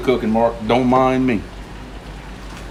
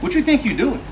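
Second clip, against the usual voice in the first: high, put-on cop voice in Cajun accent; high mock lawman calling out Boudreaux; cop in a joke; 9 semitones higher, 4 dB quieter, faster than usual. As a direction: play the scolding cop, pitched way up. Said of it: "He said".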